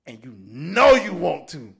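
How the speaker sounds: loud teasing. Retold or imitated. Friend pushing Tyson to drink, loud booming tease. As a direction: belt the temptation out. Repeats the tempting line loudly.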